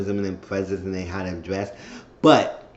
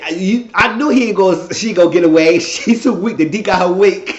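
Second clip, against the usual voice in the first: louder, cackling told-you-so; loud gloating; 7 semitones higher, 7 dB louder than usual. Retold or imitated. Danny gloating in the group chat, loud and laughing. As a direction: crow it, laughing at her.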